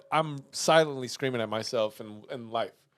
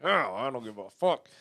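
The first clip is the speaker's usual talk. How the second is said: muttered curse; 3 semitones lower, 8 dB quieter than usual. Retold or imitated. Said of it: "I'm just cursing".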